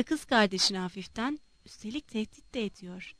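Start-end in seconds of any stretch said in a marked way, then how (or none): 1.7-3.1 much softer, low mutter to his sister; mumbled. A narrator's reading. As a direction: mutter it aside under your breath. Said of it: "diye mırıldandı".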